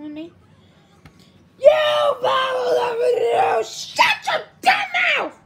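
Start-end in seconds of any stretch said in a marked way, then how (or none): 1.6-5.4 play-acted furious outburst, loud and high; loud, high-pitched mock tirade; angry shout. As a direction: yell it like a pretend telling-off.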